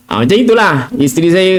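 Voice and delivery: ordinary talk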